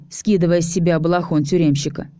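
ordinary delivery, nothing marked

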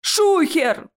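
high-pitched warning yelp; alarmed cry. Acted. Ches warns the room of the troll, crying out. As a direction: sound the alarm, pitch leaping. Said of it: "закричал Чес".

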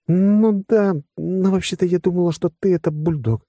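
high, mildly sheepish woman's voice; embarrassed. A narrator's reading. Woman admitting whom she took him for, mildly embarrassed. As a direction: admit it with a hesitant opening. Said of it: "смутилась она".

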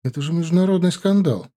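mildly alarmed, high-pitched protest; lightly acted dismay; frightened exclamation. A narrator's reading. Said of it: "испуганно воскликнула Ветка".

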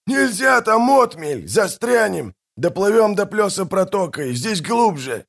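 high, urgent arguing shout; shouting back. A narrator's reading. Captain warning of running aground, shouting. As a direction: shout down the objection, high-pitched.

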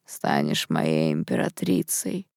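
slow, low, creaky tipsy murmur; soft, sunken drunken voice; drunken promise. A narrator's reading. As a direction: promise it drunkenly, dragging every word. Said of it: "с нетрезвыми спорить не стоит".

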